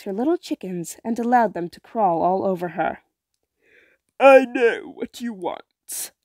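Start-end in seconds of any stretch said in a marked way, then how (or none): 4.2-6.1 slow, knowing witch croak; eerie old hag who already knows; sea witch voice. Acted. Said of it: "said the sea witch".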